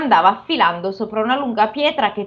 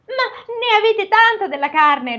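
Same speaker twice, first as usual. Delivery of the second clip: ogre's wife voice; 11 semitones higher than usual. Acted. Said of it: "ribatté la moglie".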